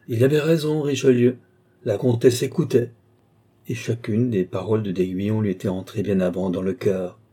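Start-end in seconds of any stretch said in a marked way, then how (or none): none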